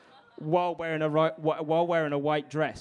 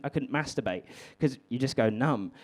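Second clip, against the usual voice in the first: ordinary talk, nothing marked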